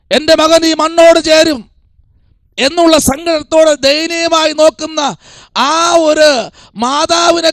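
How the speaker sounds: high, sustained sermon storytelling; fervent preaching. Real, not acted. Preacher pictures the grieving mother looking to Jesus, fervent preaching. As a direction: press on in long, high, fervent phrases.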